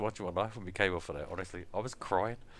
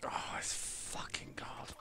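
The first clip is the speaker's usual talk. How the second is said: breathy whisper, slowed right down; whispered aside; 13 dB quieter, much slower than usual. Real, not acted. Reacting to co-host in a slow whisper. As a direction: drop to a whisper under the breath.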